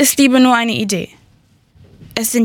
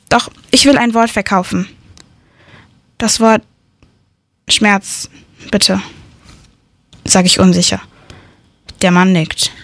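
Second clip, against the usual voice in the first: ordinary delivery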